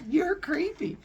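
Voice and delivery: a high, disgusted put-down; grossed out. Acted. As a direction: recoil from him, high and disgusted. Persephone answers Hades.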